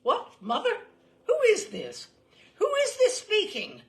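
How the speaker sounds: high, loud baffled shout; startled cry. Retold or imitated. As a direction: cry out in confusion down the line. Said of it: "I could hear a voice cry".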